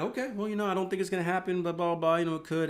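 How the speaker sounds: high-pitched mock noncommittal answer; imitated hedging reply. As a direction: act out yourself placating them, pitched high. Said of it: "And I'm like".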